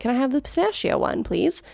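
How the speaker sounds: lifted, friendly ordering voice; with a smile. Shown as a demonstration. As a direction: ask nicely with a smile. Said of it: "with a smile, you can say".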